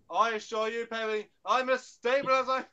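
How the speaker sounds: high, indignant, theatrical retort; hammed-up offended Doctor; Doctor impression. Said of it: "Here's my Perry and Doctor impression".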